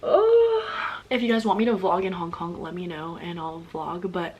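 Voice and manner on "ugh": loud, much higher whiny groan; fed-up groan. Real, not acted. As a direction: groan like you can't take another flight. Groan about hating flying.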